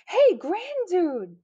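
high, drawn-out hail; singsong call from flying fish. A narrator's reading. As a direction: call slowly across the waves. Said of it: "they called".

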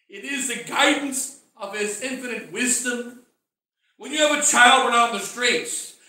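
usual speaking voice

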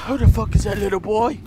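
ordinary talk, nothing marked

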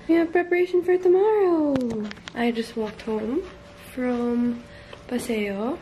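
normal talking voice

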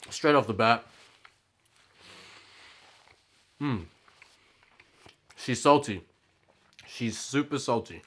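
talking while chewing; muffled by food; mouth full. Real, not acted. Seen 1: Cheeks full, jaw chewing after bite; napkin wipe mid-stretch.

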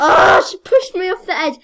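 loud indignant yell; outraged shout. Real, not acted. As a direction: shout it, betrayed. Pushed off edge in game.

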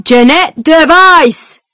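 loud, slow, high shout of a name through the door; guard calling out. Acted. Prison guard calls a prisoner to the door, loud shout. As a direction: bark the prisoner's name down the dark room. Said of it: "a guard calls".

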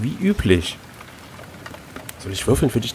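ordinary delivery

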